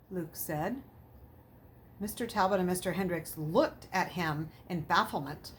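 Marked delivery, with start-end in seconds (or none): none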